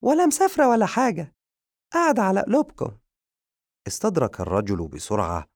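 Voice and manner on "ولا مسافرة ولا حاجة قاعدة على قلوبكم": very high, loud squeaky comeback; quick retort. Acted. Madiha retorting to the pious man, high and cheeky. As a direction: snap back fast in a high cartoon voice. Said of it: "ردت مديحة بسرعة".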